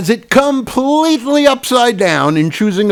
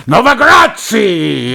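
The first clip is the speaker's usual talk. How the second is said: slow, higher hammed-up Italian; mock Italian; 4 semitones higher, slower than usual. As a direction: relish the name like an Italian. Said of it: "I love saying his name".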